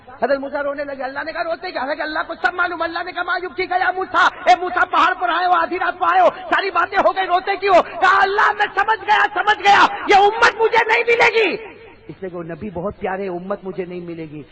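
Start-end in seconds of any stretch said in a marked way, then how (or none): 0.1-11.6 loud, high preaching voice; booming sermon. Acted. Preacher booming to the congregation at full force. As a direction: preach it out to the crowd.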